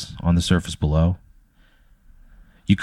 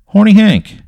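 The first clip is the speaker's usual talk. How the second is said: high, loud call of recognition; 12 semitones higher, 7 dB louder than usual. Acted. Farmer spotting Horny Hank, loud delighted hail. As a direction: call out the name, pitched way up.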